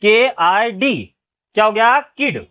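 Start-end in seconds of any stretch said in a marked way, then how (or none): 0.0-1.2 dictating letters slowly; slow spelling out. Shown as a demonstration. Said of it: "समझ में आया नहीं आया?".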